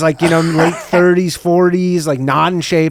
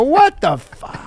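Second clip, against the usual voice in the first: shocked; 10 semitones higher, much slower than usual. Retold or imitated. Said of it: "I go".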